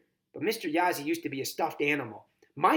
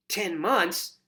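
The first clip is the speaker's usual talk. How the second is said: proud, higher, louder announcement; triumphant; 6 semitones higher, 6 dB louder than usual. A narrator's reading. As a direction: announce it like a winner. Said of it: "Mac pronounces triumphantly".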